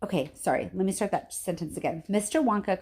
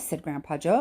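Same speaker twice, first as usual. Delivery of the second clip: plain reading voice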